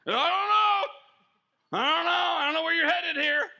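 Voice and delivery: high, loud mock wail; hammed-up panic, nearly shouting; panicked passenger. Nervous passenger to driver, hammed-up frightened wail. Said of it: "It's kinda scary".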